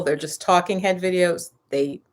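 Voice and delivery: ordinary talk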